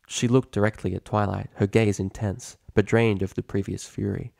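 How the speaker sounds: ordinary delivery, nothing marked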